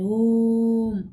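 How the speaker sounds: ओम with a long drawn-out ओ; प्लुत ओम. Shown as a demonstration. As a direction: hold the ओ in ओम. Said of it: "उच्चारण देर तक किया जा रहा है". (ओम का ओ लंबा खींचकर)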